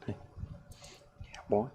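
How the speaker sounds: usual speaking voice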